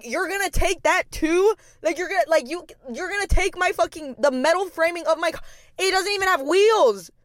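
high, incredulous protest; outraged, can't-believe-it whine; indignant disbelief. Retold or imitated. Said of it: "And I was like".

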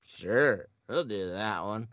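ordinary talk, nothing marked

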